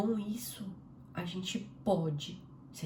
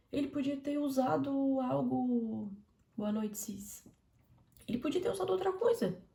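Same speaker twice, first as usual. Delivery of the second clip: rising emphasis; 7 semitones higher than usual. Real, not acted. Emphatic rhetorical build.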